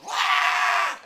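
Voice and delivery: loud high whispered crowd roar. Retold or imitated. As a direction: imitate the whole stands erupting. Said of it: "everybody in the whole place goes".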